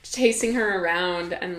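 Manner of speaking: ordinary talk, nothing marked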